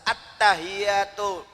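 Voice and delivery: "At-tahiyyatu" drawn out very slowly, doubled y pressed; tasdid held. Shown as a demonstration. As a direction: stretch "At-tahiyyatu" and lean on the tasdid. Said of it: "itu kan tasdid namanya". ("At-tahiyyatu" sangat pelan, tasydid ditekan)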